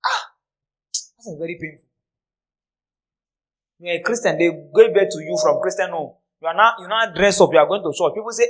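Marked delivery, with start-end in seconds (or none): none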